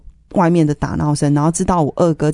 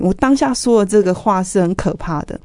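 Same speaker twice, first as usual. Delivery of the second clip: normal talking voice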